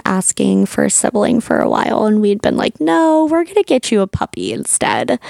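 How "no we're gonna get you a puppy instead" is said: put-on high-pitched voice for talking to kids; mock parental refusal. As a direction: imitate a parent deflecting, pitched well up. Parents putting off the kids' request.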